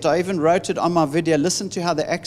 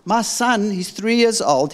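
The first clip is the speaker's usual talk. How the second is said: ordinary talk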